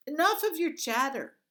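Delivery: loud, high angry bark; commanded. A narrator's reading. Guard cuts off the chattering girl, angry shout. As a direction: cut her off hard.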